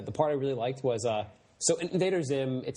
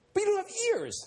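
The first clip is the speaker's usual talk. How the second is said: very high-pitched mock outburst; freaked out. Kid disputing the alien's excuse, mock freaked-out. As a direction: imitate a freaked-out kid protesting. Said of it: "one kid just is freaked out".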